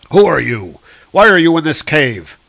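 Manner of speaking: loud, firm interrogation; demanding. Acted. Thor questioning the stranger in the cave, loud demand. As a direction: challenge the stranger loudly.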